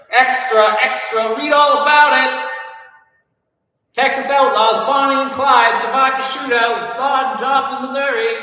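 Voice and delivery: high, loud newsboy hollering the headline; shrill-free high shouting paperboy crying the news. Retold or imitated. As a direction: belt it out like a street paperboy. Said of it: "some kid, you know, shout".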